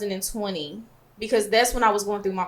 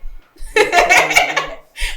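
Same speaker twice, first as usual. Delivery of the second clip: breathy laugh through tears; shaky teary laugh; 12 semitones higher, 5 dB louder than usual. Real, not acted. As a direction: laugh while fighting tears.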